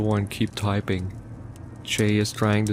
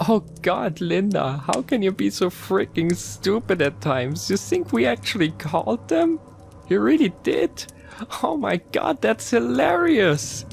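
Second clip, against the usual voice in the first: high mocking voice; 11 semitones higher than usual. A narrator's reading. Chen mocking, bursting out laughing.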